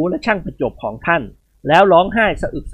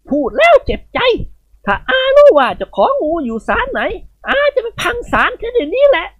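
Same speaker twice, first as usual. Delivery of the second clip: put-on high, commanding old lady's voice; a matron rallying every servant to hunt the snake; bossy old lady. Khun Ying Wad rants.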